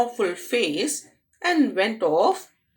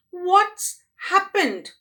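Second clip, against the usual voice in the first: put-on much higher, slow puzzled girl; bewildered question; 9 semitones higher, 4 dB louder than usual. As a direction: ask it slowly, dazed. Dazed girl wonders.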